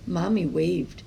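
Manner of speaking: usual speaking voice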